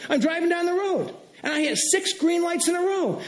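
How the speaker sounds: high-pitched, delighted preaching; excited amazement. Real, not acted. Preacher telling the church about everyday blessings, excitedly amazed. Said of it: "I'm getting a little excited here".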